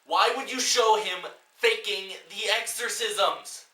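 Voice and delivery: high, loud and slow, exasperated; incredulous shouted rant; slow outraged rant. Real, not acted. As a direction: hammer the question home in disbelief. Ranting at the film.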